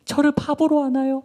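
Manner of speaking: high, loud, offended outcry; acted aggrieved complaint; indignant reproach. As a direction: protest at being made a fool. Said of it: "삼손을 막 원망했어요".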